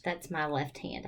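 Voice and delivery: normal talking voice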